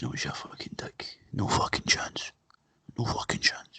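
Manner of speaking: acted hushed, dismissive whisper; whispered mock refusal. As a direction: whisper a playful flat-out refusal. Acting local refusing English money.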